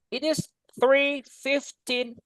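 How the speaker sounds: slow, deliberate "It is three fifteen"; slow careful English. Shown as a demonstration. Teacher to class, slow clear English model. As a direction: spell out the time slowly for students. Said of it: "Tidak pakai".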